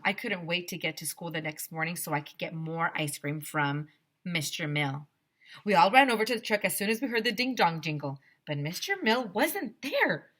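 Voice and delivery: plain reading voice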